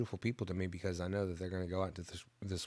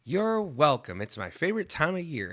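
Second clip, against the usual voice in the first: put-on high, perky child voice; mock little-kid voice, pitched up and louder; imitated little girl; 6 semitones higher, 4 dB louder than usual. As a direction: answer like a proud little Girl Scout. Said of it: "And then she was like".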